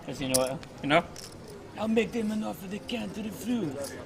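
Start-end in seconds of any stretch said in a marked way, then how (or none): 1.8-3.9 a Godfather-style mob boss line; mafia don impression. Poker commentator doing a mafia boss for a viewer. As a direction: play the Godfather making a threat. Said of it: "I'm a made man".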